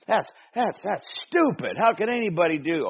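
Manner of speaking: high, loud scoffing at Jesus; grumbling crowd. Acted.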